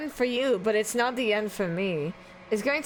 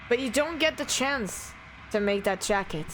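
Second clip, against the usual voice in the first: usual speaking voice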